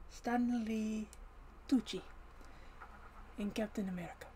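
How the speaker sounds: ordinary talk, nothing marked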